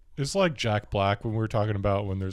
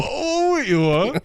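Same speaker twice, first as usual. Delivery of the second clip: high, loud whoa of mock awe; mock surprise; 6 dB louder, much slower than usual. Real, not acted. Friend reacting to the king of the castle line, mock awe. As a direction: shriek up in playful amazement.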